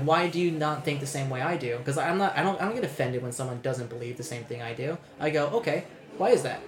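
usual speaking voice

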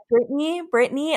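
high, questioning name call. Retold or imitated. Staff calling out her order name.

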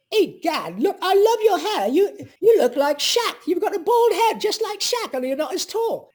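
put-on high, loud squeaky old lady; hammed-up high granny voice; old woman voice. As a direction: play the chatty old patient, way up high. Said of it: "When she found out".

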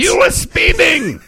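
high, slow, loud barked accusation; mock German cop. Cohost jokes a cop caught him speeding, loud and high. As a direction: play a stern foreign traffic cop. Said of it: "Why did he become German".

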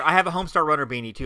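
ordinary talk

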